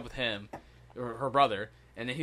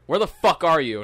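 mock-annoyed girlfriend, louder; retold angry demand; 3 semitones higher, 7 dB louder than usual. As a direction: imitate her demanding answers, punching it out.

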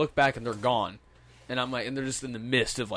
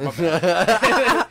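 laughing; 6 semitones higher, 9 dB louder than usual. Real, not acted. Laughing at own blunder.

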